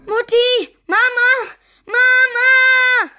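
loud, high calling for mom. Acted. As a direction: call out loud for mama. Kind ruft nachts nach Mutter. (lautes Rufen nach Mama)

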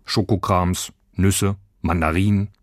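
plain reading voice

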